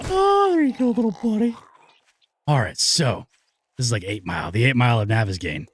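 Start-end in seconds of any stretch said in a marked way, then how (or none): 0.1-1.6 high-pitched sing-song coo. Acted. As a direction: coo at him like at a baby. Cooing at killed zombie.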